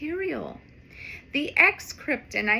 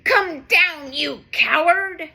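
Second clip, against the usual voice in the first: loud furious dog taunt; angry barking; 4 semitones higher, 8 dB louder, slower than usual. Acted. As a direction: bark it up the tree. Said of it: "The angry dog barks".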